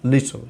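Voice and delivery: "লিটল" with a weak, swallowed last syllable; স্বাভাবিক ইংরেজি উচ্চারণ. Shown as a demonstration. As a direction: say "লিটল" short, almost no vowel before the final l. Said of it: "দেখেন আমি কি বলছি". ("লিটল"-এর শেষ syllable দুর্বল করে বলা)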